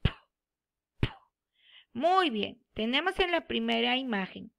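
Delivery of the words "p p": p said as a short, voiceless puff of air with no vowel; teacher showing the sound of p; letter sound. Shown as a demonstration. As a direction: pop the lips on p with no vowel after it. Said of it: "que su sonido es".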